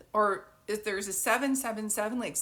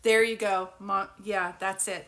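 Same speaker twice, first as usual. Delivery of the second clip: normal talking voice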